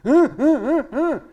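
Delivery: loud, repeated caveman grunting; short forceful grunts like a caveman. Shown as a demonstration. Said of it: "I sound like a caveman".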